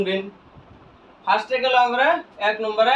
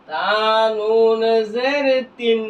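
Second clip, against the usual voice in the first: very slow, with নুন held long; slow model spelling for learners to follow; drawn-out spelling recitation; much slower than usual. Shown as a demonstration. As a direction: stretch each letter name into the next. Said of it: "বানান করলাম কি?".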